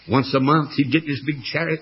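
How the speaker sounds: usual speaking voice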